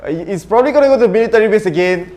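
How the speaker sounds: loud, fast and fed up; exasperated. Real, not acted. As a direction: groan at the same spot again. Annoyed at circle.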